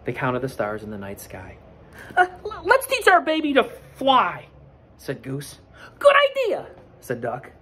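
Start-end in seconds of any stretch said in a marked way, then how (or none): 2.1-4.5 high and loud; eager Goose. Acted. Goose suggests. 6.0-6.7 very high and loud; delighted Duck. Acted. Duck agrees.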